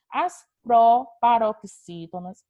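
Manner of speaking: loud, drawn-out "proparoxítonas" with small breaks; slow, emphatic enunciation. Shown as a demonstration. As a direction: spell out "proparoxítonas" piece by piece for a class. Term repeated slowly for the class.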